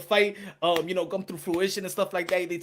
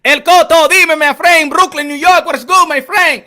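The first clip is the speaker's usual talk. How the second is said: higher, much louder greeting; loud shout-out; 8 semitones higher, 15 dB louder than usual. Real, not acted. Streamer greeting a Brooklyn viewer, loud shout-out. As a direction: call out to a friend in chat.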